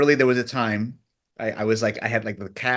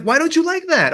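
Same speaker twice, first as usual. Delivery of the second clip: mock-baffled, pitched much higher and louder; baffled question; 6 dB louder than usual. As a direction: imitate their confused protest. Retold team baffled.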